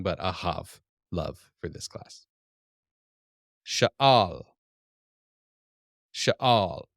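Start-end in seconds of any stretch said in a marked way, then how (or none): 3.7-6.8 Sha'al said loudly with a falling end; Sha'al, loud teaching model; Hebrew word sha'al. Shown as a demonstration. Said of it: "associate this with shall".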